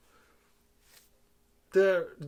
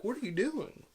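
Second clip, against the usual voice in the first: lightly mocked cheesy delivery, swooping pitch; hint of corny overacting; super cheesy; 5 semitones higher than usual. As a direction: ham it up with sing-song rises and dips. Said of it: "it just goes super cheesy".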